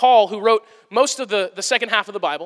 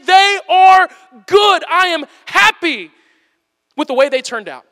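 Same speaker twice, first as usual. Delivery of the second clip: higher, louder, slow joyful shout; excited God; 5 semitones higher, 5 dB louder than usual. Retold or imitated. Preacher voices God delighted with people, joyful shout. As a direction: proclaim it like a proud delighted maker. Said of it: "he is excited about what he's made".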